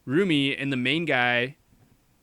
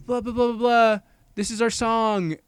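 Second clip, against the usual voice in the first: higher, louder blah-blah filler; mock performing; 8 semitones higher, 4 dB louder than usual. Girls take over the concert singing, mock performing. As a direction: toss off the lyrics in a raised mock voice.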